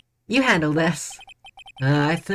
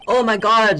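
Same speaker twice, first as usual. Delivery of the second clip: loud startled gasp; jump-scared exclamation; 7 semitones higher, 6 dB louder than usual. Real, not acted.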